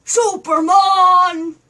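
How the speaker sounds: slow loud heroic call; Superman call. Acted. Flying the puppet, a slow loud Superman call.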